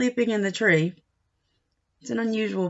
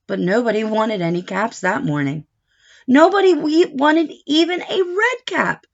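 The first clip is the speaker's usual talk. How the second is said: bright, louder storybook narration; lively read-aloud; 6 dB louder than usual. Acted. Teacher reading a picture book to a child, animated. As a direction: read it big for a little listener.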